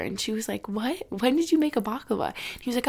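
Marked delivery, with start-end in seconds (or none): 0.7-2.3 higher, mildly incredulous retold question; mom's surprised question. Mom asks dad about the early-morning baklava, surprised. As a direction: imitate a puzzled mom, pitch lifting.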